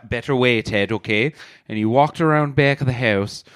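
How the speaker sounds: put-on storytelling in a broad Irish accent, rolling along. Irish bar owner telling his funeral story in an Irish accent. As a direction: spin the yarn like an Irish pub teller.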